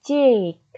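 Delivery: cheek with a loud, long I; long stressed vowel. Shown as a demonstration. Teacher showing students the long stressed I, loud and drawn out. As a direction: draw out the ee in cheek loudly. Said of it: "Panjangkan kata, eh, suku kata ini".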